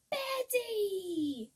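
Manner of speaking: loud joyful call; mild storybook cry. Acted. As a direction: call her name across the farm. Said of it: "cried Harry".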